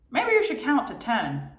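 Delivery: high, pestering inner voice; high nagging voice. Retold or imitated. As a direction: nag brightly from inside the head. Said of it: "I don't know why I'm using this voice".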